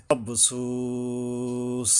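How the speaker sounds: মাবসুস with a very long, level su; three-alif stretch. Shown as a demonstration. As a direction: chant মাবসুস slowly, holding the সু. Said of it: "তিন আলিফ টানতে হবে". (মাবসুস-এর সু তিন আলিফ টেনে পড়া)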